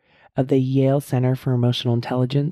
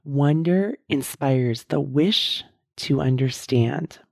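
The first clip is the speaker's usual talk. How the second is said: very slow, every word weighed; slow deliberate reading; slower than usual. Shown as a demonstration. Host reading the wonder definition to listeners, slow and deliberate. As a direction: read the definition out slowly so it sinks in. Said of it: "I'm gonna say that again".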